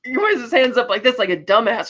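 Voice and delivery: ordinary talk, nothing marked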